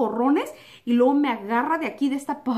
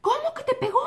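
much higher, disbelieving cry; shocked sister; 12 semitones higher than usual. Retold or imitated. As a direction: react like an alarmed big sister. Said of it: "me dice". (hermana alarmada, mucho más aguda)

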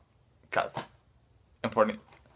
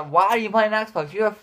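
lightly voiced Xbox hater, a bit higher and quick; a hint of a whiny fanboy jeering; mock hater; 9 semitones higher than usual. PS4 fans jeering at the Xbox owner, lightly mocking voice. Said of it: "I'm gonna get a lot of hate for".

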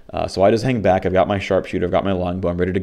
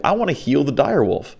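imitating naive teammates, pitched up; mock-eager; 7 semitones higher than usual. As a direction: mimic a friend's earnest, silly plan in a higher voice. Mimics party members' eager bad idea.

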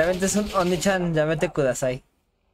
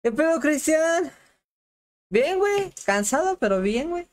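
high-pitched voice; 10 semitones higher than usual. Pretend. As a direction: greet him in a silly high voice. Greeting player in high voice.

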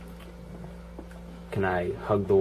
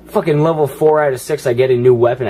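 loud, raised-pitch gaming rant; exasperated outburst; 5 semitones higher, 9 dB louder than usual. Real, not acted. Player ranting at the game over weapons, loud exasperated outburst. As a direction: burst out loudly at the game.

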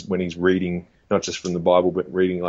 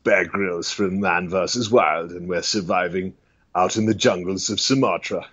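British survival-show host impression; Bear Grylls. Survivalist presenting from the jungles of Sumatra, TV host voice. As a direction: introduce the adventure like a TV survivalist. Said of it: "Bear Grylls is a very popular one".

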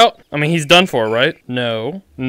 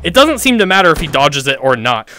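usual speaking voice

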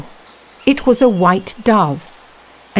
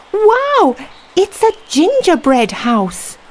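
high delighted wow; excited girl; 12 semitones higher than usual. Acted. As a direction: gasp with delight at the house. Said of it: "said Gretel".

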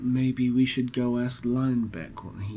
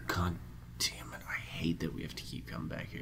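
fast unvoiced grumbling whisper; whispered grumble; 8 semitones lower, 16 dB quieter, faster than usual. Real, not acted. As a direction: mutter it under your breath. Frustrated aside at hourglass.

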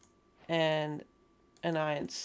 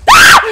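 sudden very high loud scream; panicked scream; 22 dB louder than usual. Real, not acted. Player ambushed by a mob, screaming in fright. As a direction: shriek in fright.

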